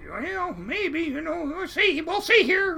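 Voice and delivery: high-pitched, hesitant squeak; imitated squeaky captain, noncommittal; squeaky little voice. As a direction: mull it over in a tiny falsetto. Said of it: "he has the squeakiest little voice".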